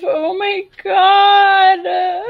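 high, slow squealing laugh. Real, not acted. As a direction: squeal with delight at something cute. Overwhelmed by cute gif.

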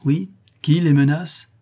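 slow, loud, high challenge; menacing question. A narrator's reading. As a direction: drag out the threat. Polidori joins the challenge.

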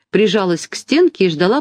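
usual speaking voice

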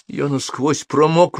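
high, slow, aggrieved complaint; indignant. A narrator's reading. Wizard complains of being soaked, indignant. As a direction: protest with mild outrage. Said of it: "возмутился Холл".

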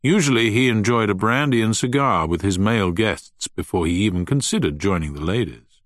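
ordinary delivery, nothing marked

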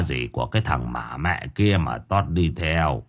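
an anxious father breaking bad news, low; old vendor voice. A narrator's reading.

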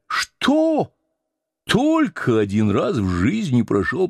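high, mock-pious scolding mate; bossy sailor. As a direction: lecture the cook like a mock preacher. Stubb mock-lectures the cook.

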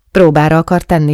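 usual speaking voice

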